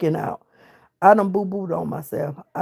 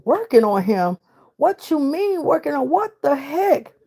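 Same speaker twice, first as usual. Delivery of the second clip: high-pitched incredulous retort; startled, rising outburst of disbelief; alarmed disbelief; 7 semitones higher than usual. Retold or imitated. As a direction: demand an explanation, voice climbing high.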